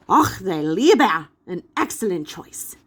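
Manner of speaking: put-on hearty German accent, burst out then settle; German scholar greets young visitors in a German accent; thick German accent. A narrator's reading. Gutenberg greets the teammates warmly, thick German accent. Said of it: "said a man with a thick German accent".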